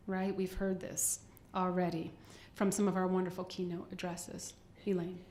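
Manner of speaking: ordinary talk, nothing marked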